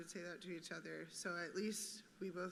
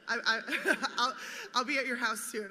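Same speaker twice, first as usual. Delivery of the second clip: laughing; 4 semitones higher, 12 dB louder than usual. Real, not acted. Laughs while quoting friend.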